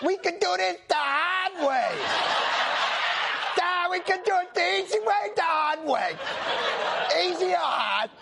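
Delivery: mock a bad mobster impression as a simpleton; Lenny from Mice and Men. Host mocking a senator's mobster impression, high Lenny voice. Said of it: "That's Lenny from Mice and Men".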